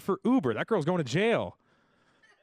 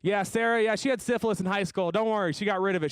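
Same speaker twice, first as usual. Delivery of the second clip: higher, faster gleeful blabbing; gossipy blabbing; 6 semitones higher than usual. Retold or imitated. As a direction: spill the secret with glee. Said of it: "I'm like".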